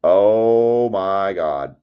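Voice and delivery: long, drawn-out, loud exclamation; stunned disbelief. Real, not acted. Breaker reacting to a huge card hit, stunned. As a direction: stretch it out in real astonishment.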